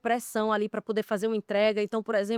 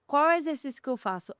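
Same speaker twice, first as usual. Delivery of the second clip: normal talking voice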